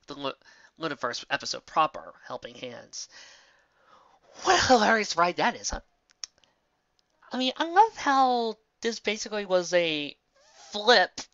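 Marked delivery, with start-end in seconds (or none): none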